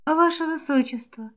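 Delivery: soft breathy whisper asking; whispered plea. A narrator's reading. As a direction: whisper it seductively. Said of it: "прошептала девушка".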